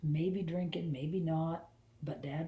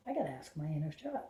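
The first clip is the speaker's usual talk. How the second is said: normal talking voice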